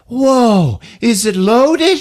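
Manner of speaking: put-on high, awed little boy; excited kid. Boy handed a rifle by his dad, high awed voice. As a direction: gasp it out like a kid with a new gun.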